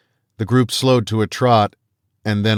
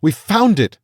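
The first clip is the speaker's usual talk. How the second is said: high, loud triumphant cry; excited shout; 11 semitones higher, 5 dB louder than usual. A narrator's reading. Hunter celebrating the find, excited high shout.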